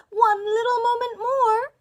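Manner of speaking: very high, loud desperate cry; frantic pleading on a high pitch; exclaimed plea. A narrator's reading. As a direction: wail for a moment's delay. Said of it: "exclaimed his wife".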